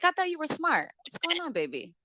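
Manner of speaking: ordinary talk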